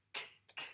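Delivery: bare voiceless "k" clicks, spaced apart; isolated k sound. Shown as a demonstration. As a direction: repeat "k" as a pure consonant, no vowel. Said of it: "qui avait le son k". (le son « k » seul, répété sans voyelle)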